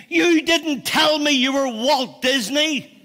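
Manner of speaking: high, loud, slow accusation; mock indignant outburst, each word leaned on; cross little girl. Daughter confronting her dad at home, acted cross. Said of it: "she went home really cross".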